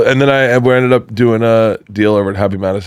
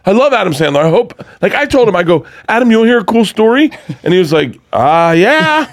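panicking; 12 semitones higher than usual. Retold or imitated. Fan babbling a nervous college story at Adam Sandler, high and fast. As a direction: gush nervously at a rush. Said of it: "I'm fucking panicking".

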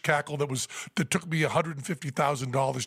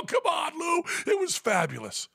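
excited coaxing. Retold or imitated. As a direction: cheer him on wildly. Coaxing Lou.

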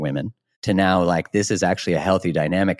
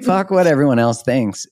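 louder emphatic repeat; 7 semitones higher, 5 dB louder than usual. Real, not acted. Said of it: "Feel free. Go".

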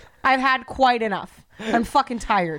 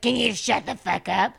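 put-on indignant grumble; mocking husband voice. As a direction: imitate an indignant, just-woken husband. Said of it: "acts indignant in the morning".

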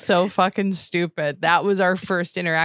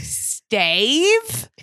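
high rising confused "Stave?"; slow puzzled "Stave?" rising; 8 semitones higher, 4 dB louder than usual. Retold or imitated. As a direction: hold a baffled stare, then ask. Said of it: "that confused look".

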